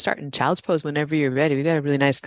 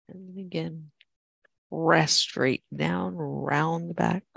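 calm, steady class cues; yoga instructor voice; 2 semitones higher, slower than usual. Acted. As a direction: guide the flow evenly.

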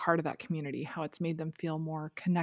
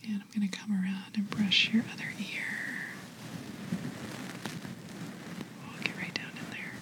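ASMR whisper. Shown as a demonstration. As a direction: whisper gently, like soothing ASMR. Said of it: "whisper into your ear".

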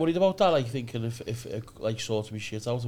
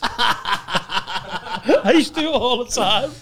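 normal talking voice

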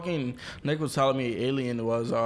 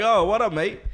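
louder, higher imitation of a British greeting; mock British accent; 7 semitones higher, 6 dB louder than usual. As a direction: imitate a friend's put-on British accent, loudly. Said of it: "using a British accent and saying "mate".

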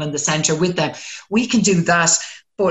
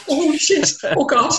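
high-pitched mock dismay; comic high-pitched dread; retold alarm; 10 semitones higher than usual. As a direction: re-enact a sudden oh-no moment. Retells her alarmed instant reaction.

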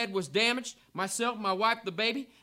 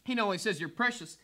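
normal talking voice

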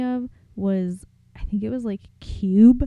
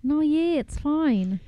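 Australian attempt sliding toward New Zealand; breezy, slightly higher mock-Australian reassurance; attempted Australian accent; 7 semitones higher, slower than usual. Co-host mimicking the Australian girlfriend, accent nearer New Zealand. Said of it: "That's actually more like New Zealand".

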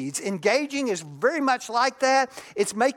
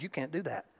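usual speaking voice

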